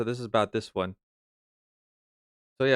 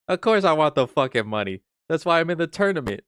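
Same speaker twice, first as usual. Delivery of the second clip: raised, higher-pitched protest; indignant; 6 semitones higher, 3 dB louder than usual. Acted. Streamer insisting the money is why he plays, indignant. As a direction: protest it as blindingly obvious.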